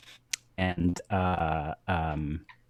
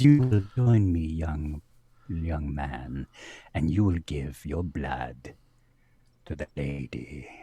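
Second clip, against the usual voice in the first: a regal British vampire luring him close; British vampire lady; 6 semitones lower than usual. Acted. Vampire lady beckons the young man in a low British accent. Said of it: "she says; she's British".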